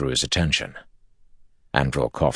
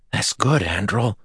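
much higher, bright relieved voice; relieved delight; 7 semitones higher than usual. Acted. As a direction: beam with relief. Evan relieved.

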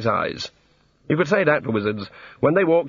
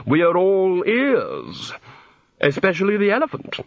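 relishing Arch-Chancellor; 7 semitones higher than usual. A narrator's reading. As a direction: stretch it out to make him squirm. Arch-Chancellor relishes Ponder's discomfort.

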